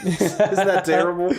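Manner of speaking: laughing through a guilty joke. Real, not acted. Joking, laughing at own remark.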